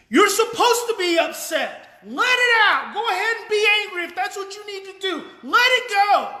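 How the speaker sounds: high, loud, near-shouted encouragement; loud angry tone. Shown as a demonstration. Coach demonstrating to parents, loud high emotional matching. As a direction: sound furious while saying kind words. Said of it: "and also listen to the tone".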